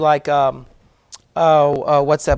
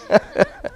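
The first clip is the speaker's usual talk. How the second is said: short laugh; 12 semitones higher than usual. Real, not acted. Laughs at own confession.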